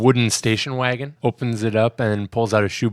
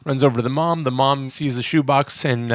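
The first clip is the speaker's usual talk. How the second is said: ordinary talk, nothing marked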